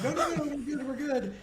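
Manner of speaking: high, hurried retold plea to calm things; alarmed placating. Pacifist with hands up placating a gunman, high retold alarm. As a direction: hold them off with a high-pitched reassurance.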